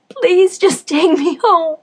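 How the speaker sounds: slow, loud, high sobbing plea. Acted. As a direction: wail it out, begging to go home. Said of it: "Pleasure sobbed over and over again".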